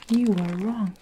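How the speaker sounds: loud, hard bark of a tyrant. A narrator's reading. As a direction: slam the verdict down at the boy. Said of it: "the Trunchbull barked".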